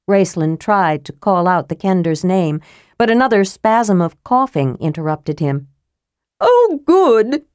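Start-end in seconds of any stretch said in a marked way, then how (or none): 6.4-7.4 high, cheerful, louder character voice; eager, chirpy young kender. Acted. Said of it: "the cheery voice went on".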